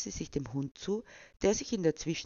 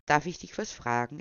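ordinary delivery

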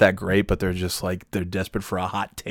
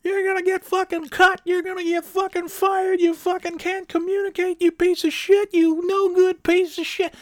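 very high squealing mock tirade; berating coach. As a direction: parody a screaming coach tearing into players. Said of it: "that whole fucking style".

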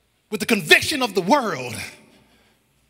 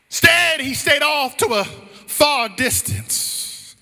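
raised, slowing preacher's cry; full-voiced sermon delivery; impassioned preaching; 6 semitones higher, 3 dB louder than usual. Real, not acted. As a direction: drive it home from the pulpit.

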